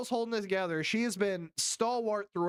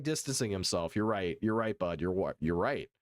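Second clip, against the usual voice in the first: usual speaking voice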